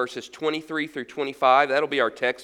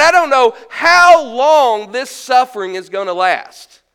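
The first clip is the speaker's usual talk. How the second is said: acted anguished cry to God, louder; anguished prayer; 4 semitones higher, 7 dB louder than usual. Preacher voicing suffering Israelites crying out to God, anguished. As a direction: plead with God in pain. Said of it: "They were saying something like".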